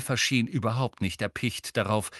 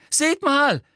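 high, loud squeal of alarm; piercing excited cry; shrieking; 7 dB louder than usual. A narrator's reading. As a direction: shriek it to make everyone look. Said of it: "kreischte Pavati".

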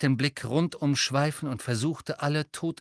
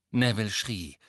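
plain reading voice

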